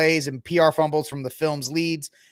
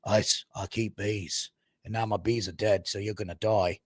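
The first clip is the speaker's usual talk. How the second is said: mock the action hero's deadpan threat; a hint of a gruff revenge-movie hero; Jason Statham impression; 7 semitones lower, 7 dB quieter than usual. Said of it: "Jason Statham being like".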